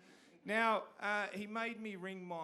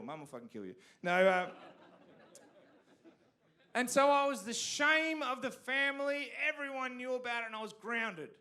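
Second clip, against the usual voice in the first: usual speaking voice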